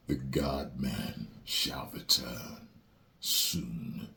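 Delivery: slow whispered proclamation; mock prophecy. Announcing his comeback, slow dramatic whisper.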